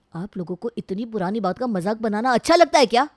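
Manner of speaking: loud, indignant accusation; angry. Acted. Husband accusing his family of mocking his past, angry. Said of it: "देखते ही भड़क जाता है".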